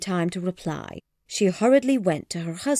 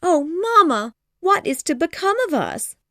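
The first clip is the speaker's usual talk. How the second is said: put-on high, louder girlish wail; a dramatic young girl crying out; cried; 11 semitones higher, 4 dB louder than usual. Lydia to her mother, high distressed cry.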